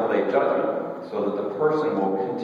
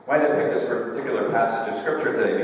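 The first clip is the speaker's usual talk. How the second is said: ordinary delivery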